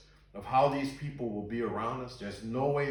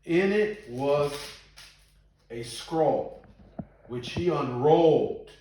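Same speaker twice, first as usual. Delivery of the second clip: loud, slow, drawn-out verse; dramatic scripture reading; 3 semitones higher, 7 dB louder, much slower than usual. A narrator's reading. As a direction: proclaim it phrase by phrase with suspenseful pauses. Reads scripture slowly and loudly.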